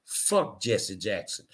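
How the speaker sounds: low, soft, slow comic growl of a line; lightly voiced comedian delivery, low and slow; Cedric the Entertainer voice. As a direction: drop it down and drag it out like a stand-up punchline. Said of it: "In my Cedric Entertainer voice".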